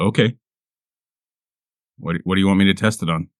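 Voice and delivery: normal talking voice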